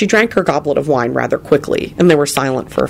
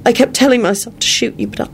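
higher, rushing out a guilty confession; blurted out; 10 semitones higher than usual. A narrator's reading. Elizabeth blurting out her confession to Lupin, higher and urgent. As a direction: let it spill out before she can stop it. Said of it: "Elizabeth blurted out".

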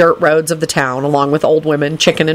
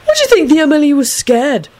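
high-pitched, gleeful question; 10 semitones higher than usual. A narrator's reading. Young man asks his companion about scared officers, gleefully. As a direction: ask it with delighted glee, voice lifted high. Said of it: "Neville asked gleefully".

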